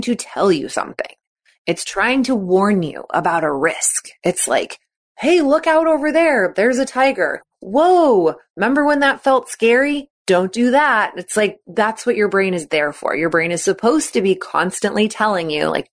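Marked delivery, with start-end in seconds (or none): 5.2-11.1 high, urgent lookout call; brain's alarmed warning. Pretend. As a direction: warn like a panicky inner alarm.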